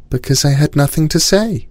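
put-on high girl's voice; little girl voice. A narrator's reading. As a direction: explain it simply as a child. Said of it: "And Cinnamon said".

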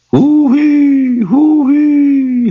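high, far-carrying call over the shoulder; high calling hoo-wee. Acted. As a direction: sing it out high like a call across the fields. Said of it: "like that".

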